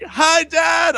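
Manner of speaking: high-pitched shouted hello; yelled greeting. Retold or imitated. Co-host greeting his friend's dad mid-recording, yelling. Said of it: "I'm yelling at him".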